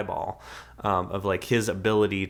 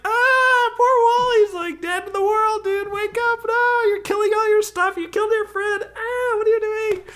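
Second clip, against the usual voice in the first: very high, loud, crying little robot; Eve's distressed robot voice; 8 dB louder than usual. Retold or imitated. Eve pleading with Wall-E to wake up, high and crying. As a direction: wail it like a tiny robot in tears. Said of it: "And it's just like".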